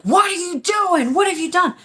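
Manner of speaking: high-pitched outraged demand, acted out; angry dad. Dad yanking his child away, angry accusing yell.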